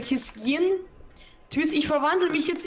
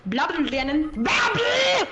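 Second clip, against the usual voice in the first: shrieked taunt; 2 semitones higher than usual. Pretend. Teasing chant ends in shriek.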